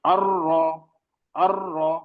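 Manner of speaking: अर्र with a firm rolled double r; trilled strong अर्र; stressed rr. Shown as a demonstration. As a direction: press the र्र of अर्र firmly. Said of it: "अब पढ़ना है".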